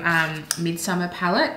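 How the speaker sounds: normal talking voice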